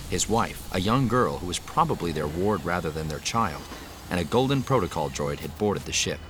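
ordinary delivery

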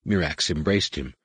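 ordinary delivery

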